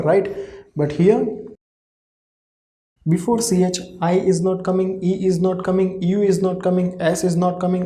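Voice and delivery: ordinary talk, nothing marked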